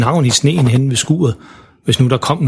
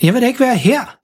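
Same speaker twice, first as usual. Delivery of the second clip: frustrated; 9 semitones higher than usual. Acted. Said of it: "sagde Emma frustreret".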